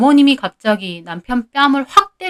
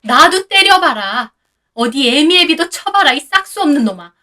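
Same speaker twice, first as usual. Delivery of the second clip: defiant taunt; 5 semitones higher, 4 dB louder than usual. A narrator's reading. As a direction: dare the son to hit her. Mother-in-law provokes son. (아들에게 덤비는 시어머니의 도발)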